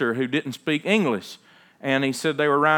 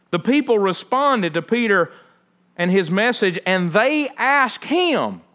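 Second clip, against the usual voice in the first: louder, higher preaching, driving each point home; a pastor preaching hard to his congregation; full-force preaching; 5 semitones higher, 4 dB louder than usual. Acted. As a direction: proclaim it from the pulpit with mounting fervor. Preaching Acts with rising force.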